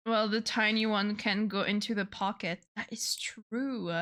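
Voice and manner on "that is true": whispered aside. Real, not acted. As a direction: breathe it, no voice. Unvoiced aside.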